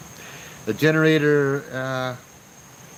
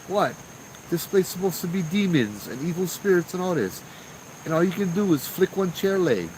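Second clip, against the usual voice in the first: higher, teasing dare aimed at the spirits; mock taunting the spirits; 6 semitones higher than usual. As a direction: taunt the ghosts for being weak. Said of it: "I almost told these spirits".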